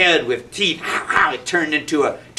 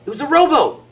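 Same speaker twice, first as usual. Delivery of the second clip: higher, louder wonder; amazed reveal; 9 semitones higher, 5 dB louder than usual. A narrator's reading. Storyteller revealing the rug is a rowboat, amazed. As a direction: announce the rowboat with delight.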